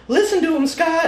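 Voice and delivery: high-pitched mock call from mom; very high, thin little cry; wee little voice. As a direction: imitate a small, piping voice shouting advice. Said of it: "is that a wee little voice".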